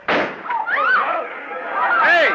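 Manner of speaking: sudden loud high shout after someone. Acted. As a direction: yell to stop him running off. Chasing after someone, calling out.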